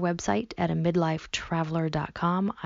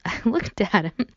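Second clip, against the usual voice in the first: ordinary delivery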